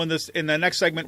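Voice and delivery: ordinary delivery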